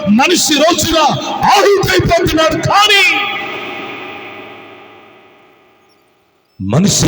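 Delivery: loud Telugu pulpit delivery; fiery preaching. Acted. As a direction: preach it out to the crowd. Preaching at full force.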